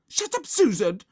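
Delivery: high, loud, furious shriek. A narrator's reading. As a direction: shriek to silence a tattletale. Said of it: "shrieked Margaret".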